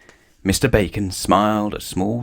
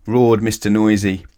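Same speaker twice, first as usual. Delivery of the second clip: usual speaking voice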